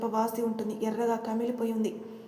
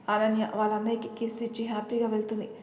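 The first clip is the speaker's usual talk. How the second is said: plain reading voice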